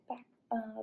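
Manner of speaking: usual speaking voice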